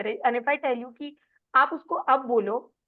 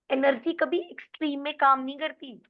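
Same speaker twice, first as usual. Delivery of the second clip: ordinary delivery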